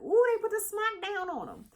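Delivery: high, thrilled ooh; excited squeal. Retold or imitated. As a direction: squeal over the blowout. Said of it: "'cause I'm like".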